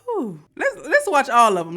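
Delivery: high and loud, blown away; whew, high and loud, itching to dig in; shocked excitement. Real, not acted. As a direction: react like you can't believe it. Reacting to shocking clip.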